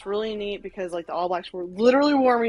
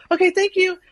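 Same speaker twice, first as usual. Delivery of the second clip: high, loud shouted thanks; re-enacted urgent thank-you; hurried thanks; 10 semitones higher, 9 dB louder than usual. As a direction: call it out as you rush off. Re-enacted hurried thanks before sprinting off.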